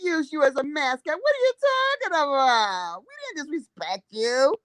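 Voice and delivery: sneering parody of an official denial; mock denial. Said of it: "they'll tell you it never happened".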